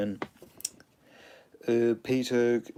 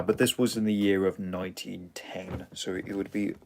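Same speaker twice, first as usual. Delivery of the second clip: usual speaking voice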